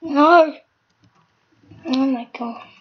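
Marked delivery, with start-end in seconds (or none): 0.0-0.6 a sudden loud, high "No!"; dismayed outcry. Real, not acted. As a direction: yelp of dismay as the game goes wrong. Sudden loss in the game.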